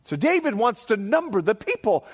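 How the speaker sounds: usual speaking voice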